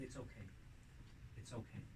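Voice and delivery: very soft, hushed comforting. Pretend. Parent hugging a frightened child, very softly. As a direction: hold the child and soothe.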